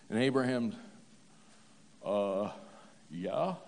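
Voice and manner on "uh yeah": low, soft, unsure answer; mildly acted reluctant yes; hesitant reply. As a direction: answer haltingly, as if wary of the question. Abraham's hesitant reply to God.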